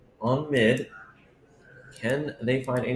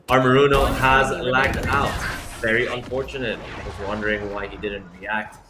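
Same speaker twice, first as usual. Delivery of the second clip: normal talking voice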